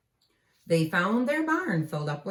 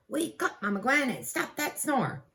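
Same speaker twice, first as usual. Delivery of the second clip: higher pleading wake-up call; lightly voiced Jack calling; a hint of a kid calling out; child calling out; 9 semitones higher than usual. Calling to Mama Gwen.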